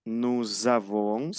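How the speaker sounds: "Nous avons" run together as nu-zavon, slowly; liaison. Shown as a demonstration. Teacher shows how the words join, slow linked "Nous avons". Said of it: "se van uniendo".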